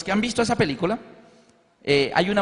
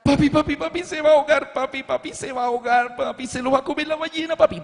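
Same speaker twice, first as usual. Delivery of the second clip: imitating panicked child; 8 semitones higher than usual. Daughter clinging to father during the film, panicked child cries. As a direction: cry out like a frightened four-year-old. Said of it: "comenzó a gritar".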